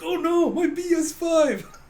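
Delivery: high, loud, drawn-out mock wail; hammed-up dismay. Gamer captain seeing his console kicked over, mock-horrified wail. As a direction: cry out over a precious wrecked console.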